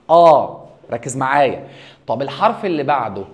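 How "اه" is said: "اه" said loud and wide open; open ah sound. Shown as a demonstration. Teacher demonstrates "اه" loudly to the class. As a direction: belt out an open "اه". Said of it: "يتنطق".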